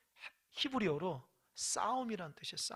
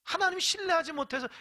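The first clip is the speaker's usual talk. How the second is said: normal talking voice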